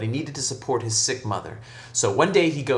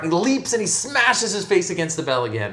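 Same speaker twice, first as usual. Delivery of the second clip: pitched-up climactic narration; excited storytelling; 7 semitones higher, 4 dB louder than usual. A narrator's reading. Storyteller at the hunchback's second leap, excited. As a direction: drive up high into the impact.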